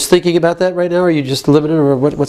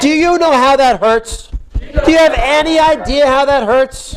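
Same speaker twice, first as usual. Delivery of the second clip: higher-pitched, rising challenge; modelled hurt anger; 4 semitones higher than usual. Shown as a demonstration. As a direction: demand to be heard.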